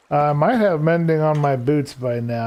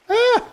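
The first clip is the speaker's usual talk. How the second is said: startled yelp. Real, not acted. Falls in the game.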